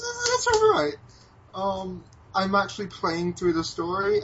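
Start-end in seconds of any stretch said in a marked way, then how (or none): 0.0-0.9 squeaky so-so. Real, not acted. Lukewarm verdict on game.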